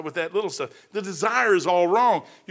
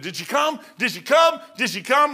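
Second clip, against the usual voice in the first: eager rising calls, louder on the second; pressing the pastor for news, voice climbing; excited repeated question; 6 semitones higher, 5 dB louder than usual. Retold or imitated. Friend on the phone asking the pastor, excited rising.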